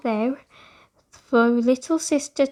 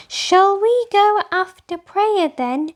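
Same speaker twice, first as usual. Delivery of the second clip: higher, louder cheerful offer; upbeat little girl smoothing things over; brightly; 8 semitones higher, 5 dB louder than usual. A narrator's reading. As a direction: lighten the mood with a bright question. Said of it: "she asked brightly".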